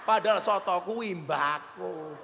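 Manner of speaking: ordinary talk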